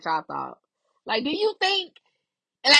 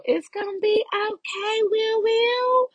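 reassuring; 13 semitones higher, slower than usual. Retold or imitated. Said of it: "had to give him a little".

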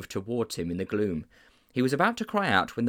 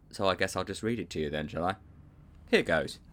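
ordinary delivery, nothing marked

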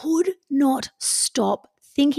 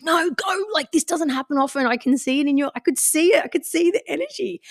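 urging friend; 6 semitones higher than usual. Retold or imitated. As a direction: egg them on like an excited friend. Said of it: "She's like".

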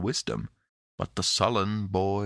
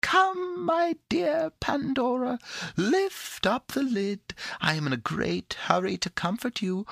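high, playful, coaxing creature; laughing little voice. A narrator's reading. The creature in the box coaxes Pandora to lift the lid, playfully. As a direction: wheedle merrily in a tiny high voice. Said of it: "cried the little voice within the box in a laughing tone".

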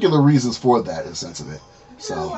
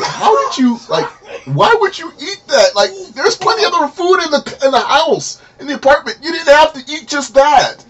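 high-pitched, retold exasperated telling-off; exasperated scolding; 13 semitones higher, 3 dB louder than usual. Scolding a friend for eating pot brownies, exasperated.

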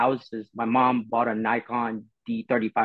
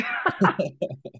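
a real laugh at himself, higher; 6 semitones higher than usual. Real, not acted. Laughing at his own early photos, a real laugh.

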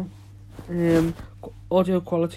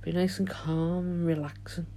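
soothing guided relaxation, fading quieter; guided meditation; 9 dB quieter than usual. Acted. Leads a meditation.

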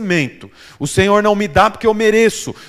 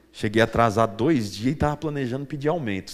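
much lower and softer, a fretful worker thinking aloud; worried employee; 9 semitones lower, 8 dB quieter, faster than usual. Pretend. Preacher voicing an anxious employee, lower and softer. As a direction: mutter it like a guilty employee. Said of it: "Funcionário pensa assim".

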